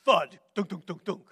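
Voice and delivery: one heavy hit with quick knocks after; imitated thuds. Imitating things thrown during a fight, percussive thuds. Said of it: "you would hear this".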